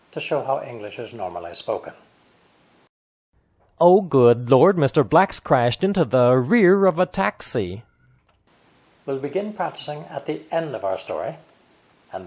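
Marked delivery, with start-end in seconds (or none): 3.8-7.8 loud exclamation, stressing "Oh", "Lord", "rear" and "taxi"; emphasized stress and intonation. Shown as a demonstration. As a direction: punch "Oh" and "Lord" loudly, keep a regular beat to "taxi". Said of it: "only emphasizing the stress and intonation".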